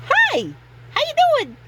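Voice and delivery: very high, bouncy and loud perky greeting; bubbly teen girl. Retold or imitated. Imitating the eager teen coworker bounding up in the break room, high squeaky greeting. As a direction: squeal it like an over-eager goth eighteen-year-old.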